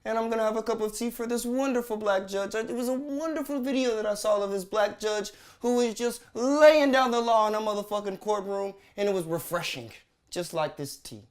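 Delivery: hammed-up, badly faked British accent; terrible British accent. Host sipping tea praises a judge, fake British accent. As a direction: put on a silly British voice. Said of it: "let me put on my terrible British accent".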